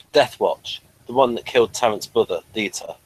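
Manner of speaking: normal talking voice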